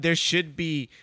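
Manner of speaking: normal talking voice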